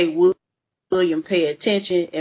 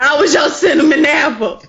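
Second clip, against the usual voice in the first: mock jilted lover; 8 semitones higher, 8 dB louder than usual.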